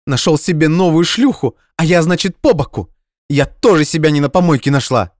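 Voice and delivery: high, loud jealous tirade; furious rant. Acted. Jilted girlfriend raging at her ex, high loud rant. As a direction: throw it all at him in a rage. Nadya ranting at Dmitry.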